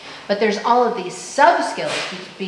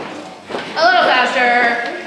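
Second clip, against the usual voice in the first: slow, stretched out and louder; calling out a speed-up; faster; 4 semitones higher, 5 dB louder, much slower than usual. Shown as a demonstration. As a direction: drag the words out, loud. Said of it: "let's speed up a little more".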